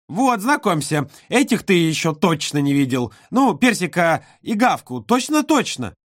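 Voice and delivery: put-on high, eager child; little girl voice. A narrator's reading. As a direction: show off toys like a little girl. Olka shows toys.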